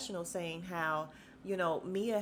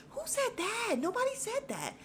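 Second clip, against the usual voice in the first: very high mock bewilderment; squeaky, incredulous protest; baffled denial. Everybody answering Jacqueline's odd denial, baffled.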